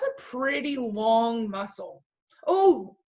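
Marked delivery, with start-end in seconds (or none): 2.5-3.0 startled. Real, not acted. Startled by cat's tail.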